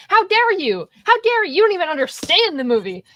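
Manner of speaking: high, hammed-up indignant "how dare you"; mock outrage. Mock outrage over Lars von Trier.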